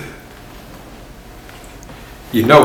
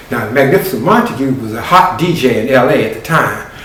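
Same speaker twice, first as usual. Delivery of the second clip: ordinary delivery, nothing marked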